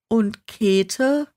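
ordinary delivery, nothing marked

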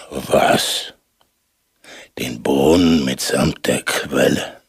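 low grumbling giant voice. A narrator's reading. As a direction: mumble it into your beard like a dim giant. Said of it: "brummte der Riese".

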